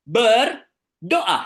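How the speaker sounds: Berdoa with a loud, high, stretched Ber, then a pause before doa; emphasis on first syllable. Shown as a demonstration. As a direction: hit Ber hard in Berdoa, then finish after a gap.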